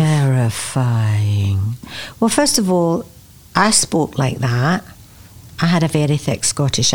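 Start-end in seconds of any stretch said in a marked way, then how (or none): 0.0-1.7 very slow, lower single word; drawn-out dread. Real, not acted. Singer recalling her first day on set, drawn-out dread. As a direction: stretch the word right out, low.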